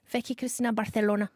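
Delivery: Barcelona with a Castilian th for the c; Spanish pronunciation. Shown as a demonstration. Correcting the co-host's Barcelona, pointedly Castilian pronunciation. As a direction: lisp the c in Barcelona, Spanish-style. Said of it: "Say it right".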